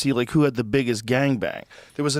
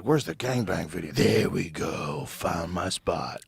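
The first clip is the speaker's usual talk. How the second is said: contented viewer; 7 semitones lower, 5 dB quieter than usual. Acted. Acting out a contented gangbang viewer.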